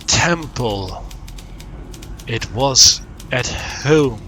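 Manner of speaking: slow, grand recital with big pauses; dramatic verse reading. Acted. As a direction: declaim it word by word, very drawn out. Reads out game verse.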